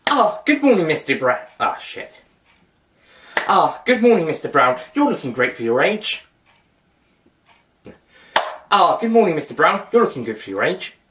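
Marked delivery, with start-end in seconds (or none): 0.1-1.5 high sing-song greeting, falling on the name; acted cheery greeting. Rehearsing an acted greeting, retakes. 3.5-6.2 acted cheery greeting. Rehearsing an acted greeting, retakes. 8.7-11.0 acted cheery greeting. Rehearsing an acted greeting, retakes.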